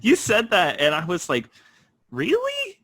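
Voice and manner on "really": high, squeaky disbelieving "Really?"; mock surprise. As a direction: jump way up in pitch, playing astonished. Retells own surprised reaction.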